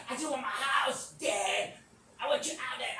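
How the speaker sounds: loud, high mock shout; imitating a fed-up daughter yelling; angry daughter. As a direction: shout him out of the house. Acting daughter throwing dad out.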